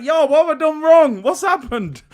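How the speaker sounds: put-on high, loud startled kid after mum's backhand; mock shocked kid. Streamer voicing a kid slapped by mum, mock shocked. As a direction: act hit by mum, confused. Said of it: "It was a little bit like".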